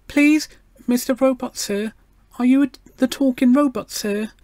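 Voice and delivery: put-on high, doubtful little girl voice; cautious treble. A narrator's reading. Gloria politely addresses the talking robot, cautious treble. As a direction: pitch it way up, polite and hesitant. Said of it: "Cautiously and doubtfully, she raised her treble voice".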